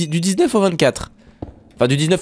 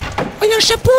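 excited squeal; faster than usual. Real, not acted. Excited at spotting a hat.

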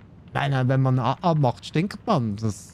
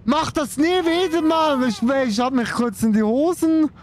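much higher, real fright after a scare; startled shriek; 12 semitones higher than usual. Real, not acted. Streamer scolding the game after a jump scare, startled and high. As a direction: yelp it out, heart still racing.

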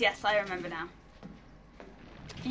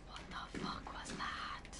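slow, voiceless whisper of dread; frightened whisper; 17 dB quieter, slower than usual. Real, not acted. Player just startled in a horror game, frightened whisper. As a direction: whisper it slowly, spooked. Spooked by something unseen.

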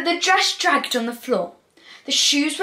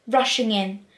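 ordinary delivery, nothing marked

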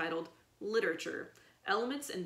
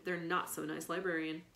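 plain reading voice

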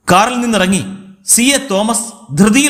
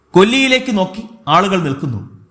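ordinary delivery

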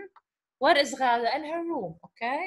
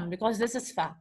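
usual speaking voice

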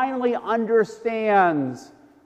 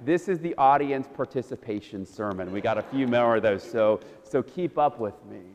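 dropped low and softer, offhand; low aside; 10 semitones lower, 6 dB quieter than usual. Real, not acted. Preacher telling congregation to keep up, low casual aside. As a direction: step out of the sermon for a low, dry aside.

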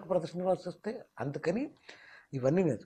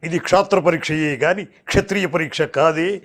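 slow, declamatory baritone film-hero voice; grand baritone impression, drawn-out words; NT Rama Rao; 5 dB louder than usual. Shown as a demonstration. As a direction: build to a loud, grave mythological oration. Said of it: "NT Ramarao లాగా".

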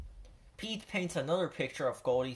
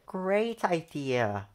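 Pete pleased; 4 semitones higher, slower than usual. A narrator's reading. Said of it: "says Pete".

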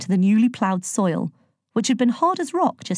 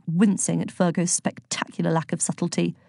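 plain reading voice

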